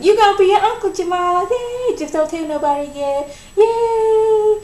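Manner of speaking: high, bouncy, half-sung glee; squealy excited announcement. Retold or imitated. Rhonda singing out her pregnancy news to Jamal, high giddy squeal. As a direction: squeal it like happy news you can't hold in.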